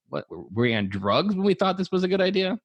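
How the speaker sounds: high disbelieving outburst; incredulous. Retold or imitated. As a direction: ask as if it was madness. Parent quoted in disbelief.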